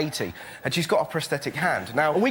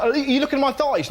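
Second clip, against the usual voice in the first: higher suspicious mum voice; imitating mum; 8 semitones higher, 3 dB louder than usual. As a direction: ask it like an affronted mother. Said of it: "so my mum said".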